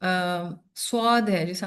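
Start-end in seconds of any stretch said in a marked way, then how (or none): none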